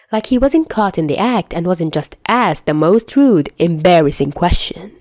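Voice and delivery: usual speaking voice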